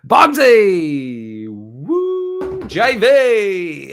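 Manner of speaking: loud, high, long drawn-out cheer; excited shouted greeting. Real, not acted. Streamer hailing crew dropping in, excited shouted greeting. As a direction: holler names and whoop in welcome. Hails viewers joining the stream.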